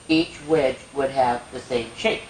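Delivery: normal talking voice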